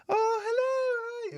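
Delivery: very high, slow delighted welcome; gushing mum greeting. Retold or imitated. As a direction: squeal a slow, high hello at the door. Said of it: "she's like".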